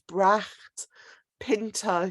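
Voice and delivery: ordinary delivery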